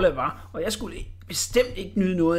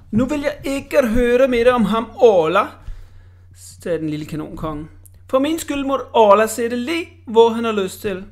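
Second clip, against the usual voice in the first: loud dismissive; 6 semitones higher, 7 dB louder than usual. Acted. Cannon king brushes off Orla.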